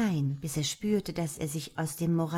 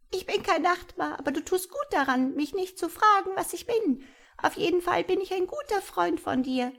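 high, chirping little creature; friendly creature; 12 semitones higher than usual. A narrator's reading. Tiny creature reassuring frightened Tom, high and chirpy. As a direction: reassure him in a tiny birdlike voice.

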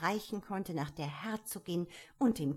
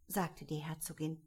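ordinary delivery

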